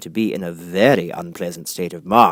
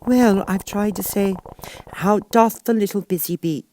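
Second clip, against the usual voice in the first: melancholy; 12 semitones higher than usual. A narrator's reading. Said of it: "in a very melancholy voice".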